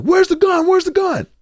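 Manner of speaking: high, loud repeated demand; police demanding. Retold or imitated. Officers to the boys, demanding the gun, high and loud. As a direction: press them hard for the gun.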